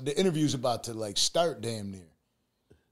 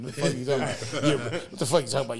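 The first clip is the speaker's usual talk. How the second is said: laughing while mock-denying; laughing through it; faster than usual. Retelling his baby-news denial to friends, laughing. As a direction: push the words out through laughter.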